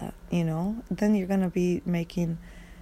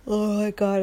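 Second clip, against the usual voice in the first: louder, startled exclamation; 4 semitones higher, 5 dB louder than usual. Real, not acted. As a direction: blurt it out, suddenly alarmed. Startled after the gasp.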